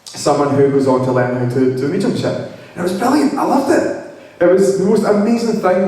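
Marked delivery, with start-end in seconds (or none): 2.8-5.9 bright, high delight on loved; rising high with real joy; excited gush. Real, not acted. Gushing about loving mediumship.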